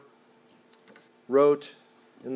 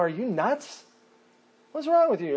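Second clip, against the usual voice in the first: mocking disbelief; 6 semitones higher than usual. Onlookers mocking Jesus.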